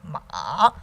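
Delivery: MA low, dipping then rising; third tone. Shown as a demonstration. Teacher reviewing all tones, dipping MA. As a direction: scoop MA down and back up. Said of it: "third tone".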